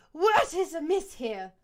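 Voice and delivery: loud slow stern ask; loud demanding question. Acted. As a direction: demand an answer. Leader demands silence.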